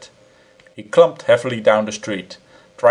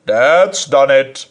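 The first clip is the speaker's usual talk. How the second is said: deliberate threat; 3 semitones higher, 7 dB louder, much slower than usual. A narrator's reading. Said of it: "said he".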